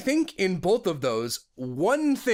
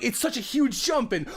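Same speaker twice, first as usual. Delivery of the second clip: usual speaking voice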